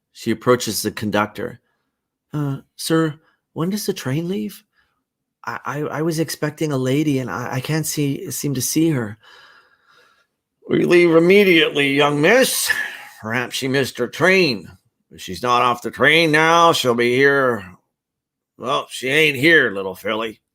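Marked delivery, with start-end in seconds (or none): 2.3-9.2 put-on higher, childlike, hesitant questions; a lost little girl asking for help; young girl, puzzled. Young Queenie speaks to conductor. 10.7-17.6 louder, brisk official voice; a busy conductor brushing her off; train conductor. Acted. Conductor answers the girl. 18.6-20.4 a drawling country voice; folksy porter. Acted. Porter talks to the girl.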